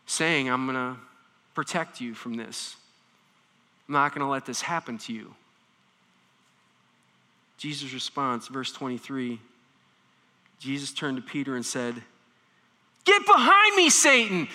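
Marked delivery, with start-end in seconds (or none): 0.5-5.3 softer, lower caring promise; caring friend. Retold or imitated. A loving friend promising protection, softly. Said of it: "somebody who loved you and cared for you". 13.0-14.5 loud, much higher sharp rebuke; intense rebuke. Retold or imitated. Jesus rebuking Peter, loud and fierce. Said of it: "delivered with the same intensity".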